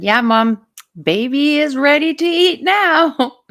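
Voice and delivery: loud, high playful announcement; calling out. Retold or imitated. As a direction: call it out to a parent, pitch lifted. Retells calling out to the mom.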